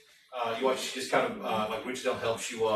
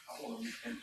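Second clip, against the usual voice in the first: ordinary delivery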